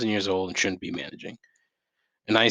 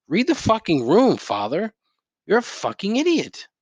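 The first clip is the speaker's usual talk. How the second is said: higher, louder jab at the priest; mock yell; 10 semitones higher, 3 dB louder than usual. Telling the priest who caught Covid to read the room, yelling. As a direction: shout it at him like he can hear.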